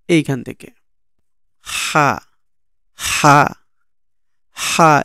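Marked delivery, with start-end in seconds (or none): 1.6-5.0 "হা" squeezed from mid-throat; pressed throat sound. Shown as a demonstration. Teacher shows where "হা" comes from, pressed throat sound. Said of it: "একটু চাপাই ধরে".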